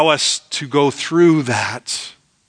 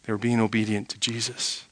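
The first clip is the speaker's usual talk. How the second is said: much softer, dropping the repeat; soft echo; 5 semitones lower, 11 dB quieter, faster than usual. Shown as a demonstration. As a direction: let it sink in quietly.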